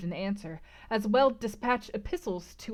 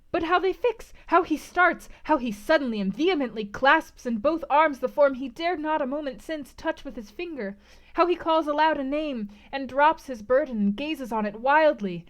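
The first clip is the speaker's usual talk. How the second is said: excited; 7 semitones higher, 6 dB louder than usual. A narrator's reading. Narrator imagines frantic discovery.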